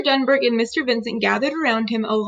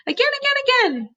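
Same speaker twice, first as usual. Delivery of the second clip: put-on much higher, excited little-kid chant; eager toddler; 13 semitones higher, faster than usual. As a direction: bounce it like a thrilled toddler. Toddler Fudge eager to retry.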